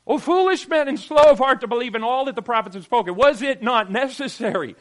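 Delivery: loud, high scolding rebuke; Jesus rebuking the two disciples, loudly. Acted. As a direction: scold them like a teacher. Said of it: "He said to them".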